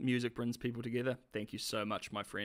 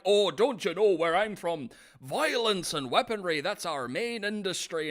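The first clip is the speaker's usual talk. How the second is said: louder, high mock-Irish villain; Irish accent; 6 semitones higher, 5 dB louder than usual. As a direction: imitate the bad guy in broad Irish. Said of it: "You do an amazing Irish accent".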